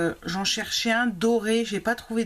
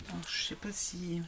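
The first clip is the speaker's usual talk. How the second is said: whispered aside; 10 dB quieter than usual. Real, not acted. Talking to herself while searching.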